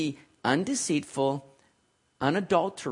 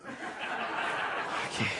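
breathy laugh; 12 dB quieter than usual. Real, not acted. Laughs at own mocking.

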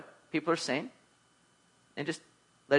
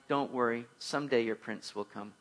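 soft, low punchline delivery; a touch of kindly consoling clerk; gently, letting the pun land quietly; 6 semitones lower, 10 dB quieter than usual. A narrator's reading. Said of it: "said kindly".

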